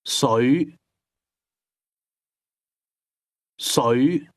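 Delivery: 水 rising from mid pitch. Shown as a demonstration. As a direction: start 水 mid and rise. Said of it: "Water is pronounced with a mid-rising tone".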